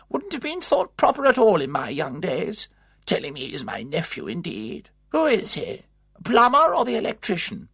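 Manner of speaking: high, indignant scolding; angry. A narrator's reading. Aunt scolding the matron about the so-called nephew, angry. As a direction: scorn the visitor sharply. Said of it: "addressed Miss Packard angrily".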